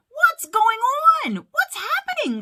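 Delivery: very high, loud panicked questions; alarmed cry. A narrator's reading. Mike watching the laugh meter drop, crying out alarmed. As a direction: cry out in alarm as things go wrong. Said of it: "cried Mike".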